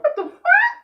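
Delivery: very high, slowed squeal of disbelief with a laugh; laughing shock. Retold or imitated. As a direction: stretch it out in shrill laughing shock. Shocked reaction during sex.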